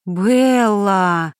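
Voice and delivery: long drawn-out exclamation of a name; exclaiming. A narrator's reading. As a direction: call the name out in stretched protest. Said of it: "воскликнула Ариэль".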